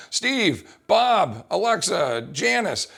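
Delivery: loud high shouts of each name; yelling out names. Shown as a demonstration. Coach wrongly shouting names over the group, yelling. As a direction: call the names across a busy gym. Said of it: "start yelling out".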